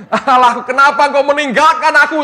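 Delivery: loud, high cry to God; imitated shout. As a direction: shout it out as the crucified man did. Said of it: "Dia teriak".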